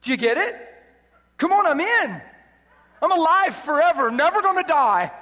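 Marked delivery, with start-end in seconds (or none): 1.4-5.1 joyful shout. Real, not acted. Preacher rejoicing in eternal life.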